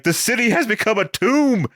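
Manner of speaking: high-pitched mock doom cry; hammed-up cry of doom; melodramatic despair. Somebody as all the lights go out, mock despair. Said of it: "somebody's like".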